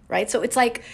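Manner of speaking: usual speaking voice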